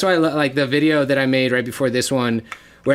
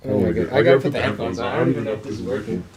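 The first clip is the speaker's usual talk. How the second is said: deep pitch shifted; 4 semitones lower, much faster than usual. Real, not acted. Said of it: "I'll keep the voice on".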